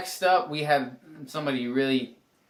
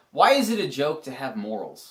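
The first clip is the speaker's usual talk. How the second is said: usual speaking voice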